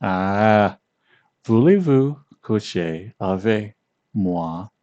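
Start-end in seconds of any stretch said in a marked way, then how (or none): 1.4-4.7 "Voulez-vous coucher avec moi?" drawn out slowly, each syllable separate, rising at the end; slow careful romantic French, English accent; slow seductive French. Shown as a demonstration. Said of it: "it will sound very romantic".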